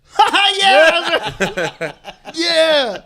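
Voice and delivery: high, loud fake laughing reply; mock delayed laugh. Co-host abroad reacting late on Zoom, mock laughing. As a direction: play a belated, overdone laugh. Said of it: "and you're like".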